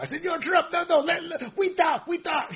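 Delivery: high, hammed-up Chinese-Panamanian accent; mock Chinese-Panamanian accent. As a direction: plead in a comic put-on Chinese-Panamanian accent. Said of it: "This is a China from Panama".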